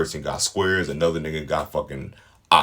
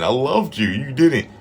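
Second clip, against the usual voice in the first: mock crying; 8 semitones higher, 6 dB louder than usual. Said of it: "he was crying at first".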